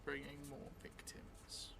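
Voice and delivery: slow, drawn-out in-character telling, often hushed; slow story narration. Acted. Vampire player dictating a new memory to the audience, slow hushed narration. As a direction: spin the vampire's memory word by word.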